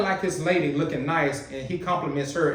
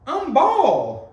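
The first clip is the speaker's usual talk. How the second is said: much higher, louder mock wail of "bald"; mimicking her cry; 12 semitones higher, 7 dB louder than usual. Host mimics the crying woman's line in a high mock wail. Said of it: "you heard what she said?".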